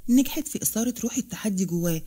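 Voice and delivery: usual speaking voice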